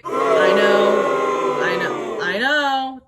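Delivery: "I know" blurted out loud; a defensive, loud "I know". Real, not acted. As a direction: cut the viewers off with a loud "I know". Talking back to viewers.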